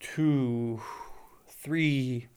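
calm meditative counting; soft counting. Shown as a demonstration. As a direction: count each breath quietly and slowly. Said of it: "I'm just counting breaths".

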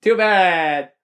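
high, slow odd voice; weird voice. Real, not acted. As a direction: weird strained voice. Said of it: "My voice sounds weird now".

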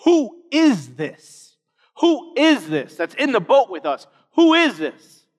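awestruck. Retold or imitated. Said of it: "terrified and say".

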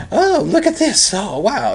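high-pitched, marvelling posh English; put-on upper-class English amazement. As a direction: gush in a mock upper-crust English accent. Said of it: "he's doing that, right?".

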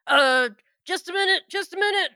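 loud, high, hurried cover-up; flustered shout. Acted. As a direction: call out caught in the act. NPC flustered behind door.

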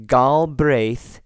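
Galbraith slowly, with full "BRAITH"; corrected pronunciation. Shown as a demonstration. Podcaster correcting a clan name, Galbraith with long second syllable. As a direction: draw out Galbraith, gal-BRAYTH. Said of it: "The emphasis on the second syllable".

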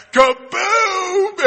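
high, loud burst of fun; a dad hitting the pool after the slide, with a high, loud kaboom; excited kaboom. Acted. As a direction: shout it like a big splash. Whooshing down the big slide.

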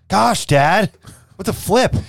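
put-on high bratty teenager; whiny teen voice.

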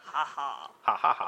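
slow laughing ha ha. Real, not acted. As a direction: laugh the syllables out. Laughing at the spelling.

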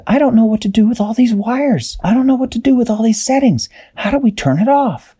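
high, fretting character voice; Dashi's voice. A narrator's reading. Dashi at the box, high worried voice. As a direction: pitch way up, puzzled and worried. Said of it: "Dashi said".

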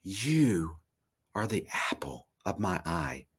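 soft, low, falling into a whisper; God's hushed declaration. Acted. Preacher voices God declaring affection to listeners, hushed to whispered. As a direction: start hushed and sink to a whisper. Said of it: "heard God declare".

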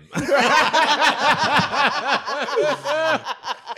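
long, loud high-pitched laugh; burst of laughter. Real, not acted. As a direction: crack up loudly and keep it going. Cracking up at teacher's retort.

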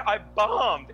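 "bombed" punched high; mock-exasperated stress on "bombed"; emphatic correction. Correcting mother, insisting on the word.

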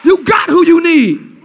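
loud, high, hammered-out preaching; shouting sermon climax; full-force preaching. Acted. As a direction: preach it hard over the music.

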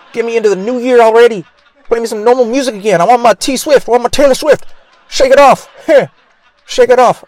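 high, loud hammed-up tantrum; mock desperate American. Americans craving [name] over Christmas songs, mock outraged yelling. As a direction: yell it like a deprived pop fan. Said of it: "That's how Americans sound like when".

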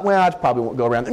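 quick, offhand mock shrug; casual version he rejects, said lightly. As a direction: toss it off like it doesn't matter. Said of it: "It was just not".

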